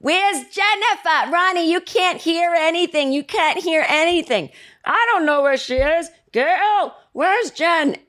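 high, strained shouting impression; bickering parents hollering. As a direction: imitate hard-of-hearing parents yelling between rooms. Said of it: "And my dad will go".

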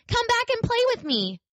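little rabbit calling out to the vanishing rabbits; crying out after them. Acted. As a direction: call after them desperately. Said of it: "cried the little rabbit".

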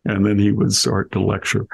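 usual speaking voice

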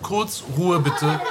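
"kurz Ruhe bitte" slow, each word spaced; strict teacher. Real, not acted. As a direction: drag out "Ruhe bitte" slowly and firmly. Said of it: "lasst mich doch nicht immer schimpfen".

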